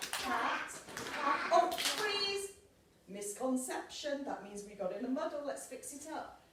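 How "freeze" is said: usual speaking voice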